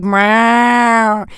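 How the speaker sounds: long, loud imitated cat wail; wailing cat meow. Imitating Henry heard from the mailroom, wailing. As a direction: yowl like a lonely cat. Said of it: "like, wailing".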